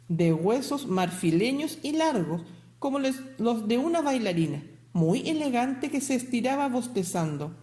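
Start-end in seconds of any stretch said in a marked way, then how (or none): none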